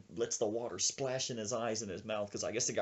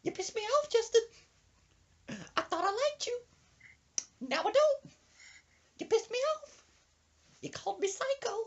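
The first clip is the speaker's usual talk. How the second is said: halting, very high falsetto grievance; aggrieved squeaky granny voice; fuming old woman. Retold or imitated. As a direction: imitate her sulky outbursts up high. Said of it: "poking her head in the apartment going".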